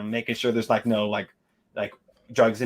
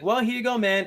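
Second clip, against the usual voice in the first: pitched way up, playful; a goofy high-pitched handoff; high mock voice; 8 semitones higher than usual. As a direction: hand it over in a silly high voice. Guy hands over item, high.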